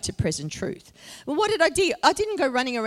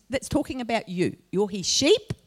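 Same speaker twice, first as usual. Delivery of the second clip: ordinary talk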